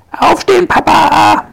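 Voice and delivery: high, loud wake-up call. Acted. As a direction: shout it so Papa wakes up. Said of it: "ruft Fuchsia".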